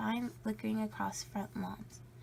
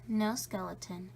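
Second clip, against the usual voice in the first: usual speaking voice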